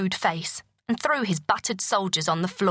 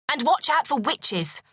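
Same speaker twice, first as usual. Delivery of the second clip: higher call after them; 6 semitones higher than usual. A narrator's reading. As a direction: call the warning as she leaves. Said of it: "she called".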